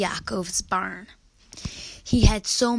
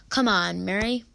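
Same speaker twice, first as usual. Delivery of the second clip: ordinary delivery, nothing marked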